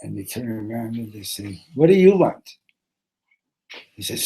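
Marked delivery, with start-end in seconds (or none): 1.7-2.5 loud, high challenge; irritated retort. Acted. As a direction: throw the question back loudly.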